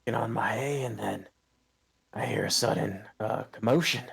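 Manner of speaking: put-on halting old-timer voice, starting high; flustered old farmer. Blind farmer explains the commotion to intruders, flustered and halting. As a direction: stammer along as a bewildered farmer.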